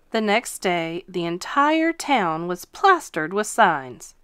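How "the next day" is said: plain reading voice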